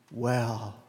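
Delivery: softly whispered, breathless and tender. Acted. Preacher voicing God's words over Jesus, whispered. Voice from heaven quoted.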